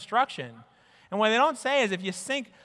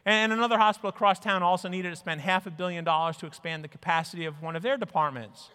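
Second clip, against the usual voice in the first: ordinary talk, nothing marked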